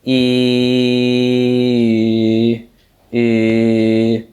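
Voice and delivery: long rounded closed vowel. Shown as a demonstration. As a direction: hold the I with rounded lips, closed. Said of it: "if you were to compare the two".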